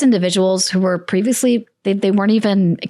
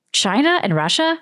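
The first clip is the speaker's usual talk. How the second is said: Trump's China; 6 semitones higher than usual. Retold or imitated. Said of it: "that's how Trump says China".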